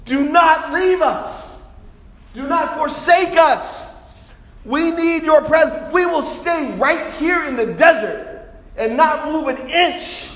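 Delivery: high, slow shouted plea; full-force preacher's desperate appeal; impassioned pleading. Acted. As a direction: cry out to God, stretching each word. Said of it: "He pleaded with God".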